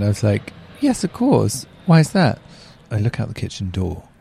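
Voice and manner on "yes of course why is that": high-pitched, over-polite retold reply; mock-innocent politeness. Dog owner answering complaining neighbour, mock-innocent high voice. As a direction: act sweetly oblivious, pitched right up.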